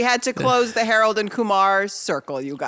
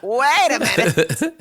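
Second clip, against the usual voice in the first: mock realization; 3 semitones higher, 4 dB louder, much slower than usual. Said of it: "And I'm like".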